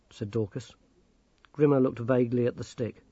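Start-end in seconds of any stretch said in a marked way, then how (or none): none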